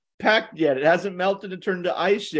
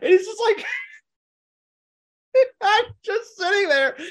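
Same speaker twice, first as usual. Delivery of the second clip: much higher, breaking into laughter; can barely get the words out for laughing; cracking up while telling the family story; 3 dB louder than usual. Real, not acted. Cracking up retelling knife gift.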